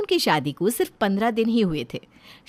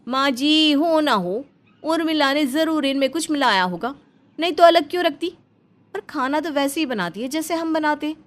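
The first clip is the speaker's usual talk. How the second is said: insistent daughter-in-law; 6 semitones higher than usual. Acted. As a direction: press the theory, pitched up and urgent. Komal insists Urmila mixes something. (ज़ोर देकर शक जताती बहू)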